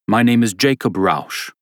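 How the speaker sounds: put-on formal German-accented introduction. Jacob Rausch introducing himself, German accent. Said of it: "said a man with a thick German accent".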